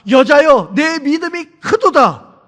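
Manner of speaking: high, loud proclamation, slowing at the end; amazed praise. Retold or imitated. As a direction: exclaim it as Jesus astonished by her faith. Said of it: "예수님이 충격받아서". (감탄하며 높고 크게 선포하는 예수님 흉내)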